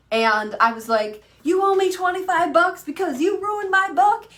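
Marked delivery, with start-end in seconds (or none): 1.4-4.3 high, loud mock-stern bill collector; hammed-up tough creditor, much higher and louder; joking mock demand. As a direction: playfully demand payment from your son. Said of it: "just joking it up".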